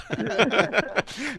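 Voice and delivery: high rising burst of laughter; real laugh. Real, not acted. Host laughing at his own impression. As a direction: laugh out at the joke.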